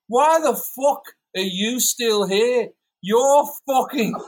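loud, high, very slow mock outrage. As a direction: stretch the insult out like an indignant British rocker. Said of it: "such a good fucking impersonation of them".

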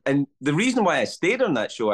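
ordinary talk

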